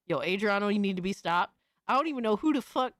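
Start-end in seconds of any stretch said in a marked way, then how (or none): none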